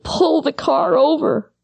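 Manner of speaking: a hint of a four-year-old pleading to stop the car; little boy urging. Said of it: "And he said".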